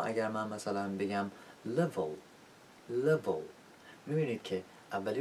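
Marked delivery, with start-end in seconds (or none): 1.8-3.4 "level" with clear first L, swallowed last L; dark final L. Shown as a demonstration. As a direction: let the end of "level" sink back into a dark L. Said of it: "دومی که پایانی باشه".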